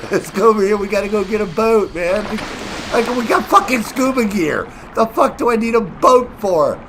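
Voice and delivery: high, loud mock teammate voice; hammed-up know-it-all teammate; mocking newbies. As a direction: play the cocky newbie insisting on a boat.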